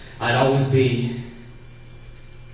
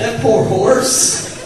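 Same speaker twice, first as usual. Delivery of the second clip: even slower, higher and loud; mock pity; 10 semitones higher, 7 dB louder, much slower than usual. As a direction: stretch every word of fake pity.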